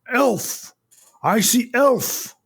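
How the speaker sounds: high-pitched, drawn-out troll cry; bellowing troll. Acted. Troll spots an elf by the fire, bellowing. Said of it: "then bellows and shouts".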